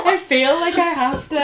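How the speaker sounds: words through a giggle; laughing as you speak. Real, not acted.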